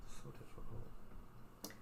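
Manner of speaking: whispered, slow sigh of despair; mock exasperated whisper. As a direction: breathe it out, fake defeated. Teasing exasperation.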